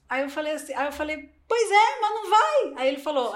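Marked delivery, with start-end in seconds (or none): 1.5-2.8 higher and louder, a light put-on whine of her past self; half-hearted complaining self; mock past self. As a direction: voice her younger doubtful self, raised up. Her own past reply retold.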